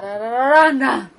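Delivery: 「タラララランラン」 hummed loud, melody pushed to the end; tune demo. Shown as a demonstration. Demoing Kansai melody by humming: loud, end-weighted. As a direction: hum 「タラララランラン」 with the push at the back. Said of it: "後ろにアクションが持ってくる".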